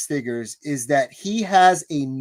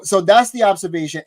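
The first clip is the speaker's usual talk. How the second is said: ordinary talk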